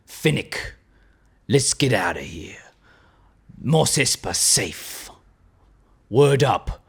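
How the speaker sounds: low hushed bounty hunter voice; Boba Fett voice. Retold or imitated. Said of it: "I'll do it in the accent".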